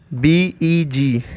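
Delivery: slow letter-by-letter spelling. Shown as a demonstration. Teacher spells a new word for learners, slow spelling. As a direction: spell it slowly, each letter held apart.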